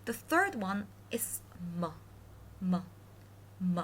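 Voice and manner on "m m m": M as a short hummed "muh"; Chinese m sound. Shown as a demonstration. Teacher demonstrates the third initial, short sound. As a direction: say "m" as in mother, briefly. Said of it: "how you pronounce M in mother".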